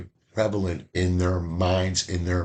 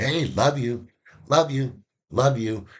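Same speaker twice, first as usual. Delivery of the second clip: casual love you; 5 semitones higher than usual. Retold or imitated. Person telling everybody love you.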